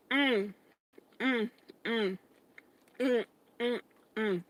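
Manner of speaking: chewing while humming; mouth full. Real, not acted. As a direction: hum through a mouthful. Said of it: "talking with my mouth full".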